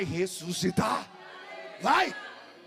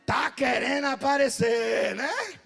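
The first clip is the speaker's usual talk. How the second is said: mocking; much slower than usual. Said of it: "como diz lá em Pernambuco".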